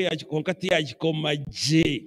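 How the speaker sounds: ordinary talk, nothing marked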